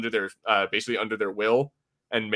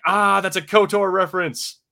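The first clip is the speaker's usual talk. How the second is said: excited recognition; 8 semitones higher, 3 dB louder than usual. Retold or imitated. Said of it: "And I'm like".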